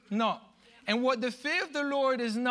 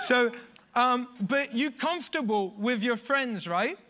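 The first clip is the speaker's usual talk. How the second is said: ordinary talk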